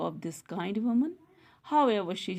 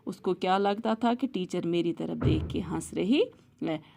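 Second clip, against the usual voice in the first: plain reading voice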